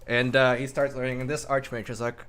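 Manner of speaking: usual speaking voice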